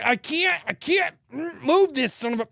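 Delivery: high, strained mock struggle; acted straining frustration. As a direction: act out heaving at a stuck bolt. Acts out fighting a stuck bolt.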